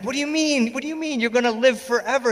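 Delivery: high, disbelieving protest; incredulous high protest. Retold or imitated. Son protesting to his father, high and incredulous. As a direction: squeak out your disbelief.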